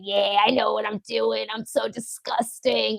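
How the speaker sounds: soft, drawn-out sarcastic self-mockery; mock agreement. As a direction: play along sarcastically, slow and quiet. Sarcastically agreeing with a hostile commenter.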